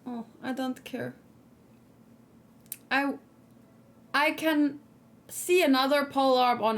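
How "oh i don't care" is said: ordinary delivery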